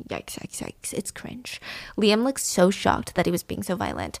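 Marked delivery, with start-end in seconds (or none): 0.1-1.6 soft unvoiced whisper. Real, not acted. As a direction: breathe it out in a whisper. Whispered reaction to memory.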